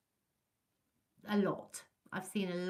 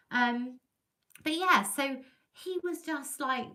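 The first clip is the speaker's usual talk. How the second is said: ordinary talk, nothing marked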